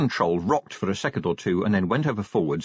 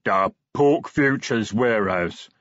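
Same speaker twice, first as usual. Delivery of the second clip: slow, ponderous troll; slow troll delivering a message; 3 semitones higher, 4 dB louder, slower than usual. A narrator's reading. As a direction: spell it out slowly, as a troll. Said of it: "said the troll".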